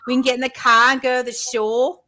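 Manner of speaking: put-on high-pitched Philly accent; Delco Philly accent. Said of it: "Delco, do it".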